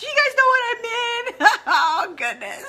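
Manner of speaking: high, breathless laughter through the words; laughing helplessly. Real, not acted. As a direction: laugh your way through the question. Overcome, laughing on stream.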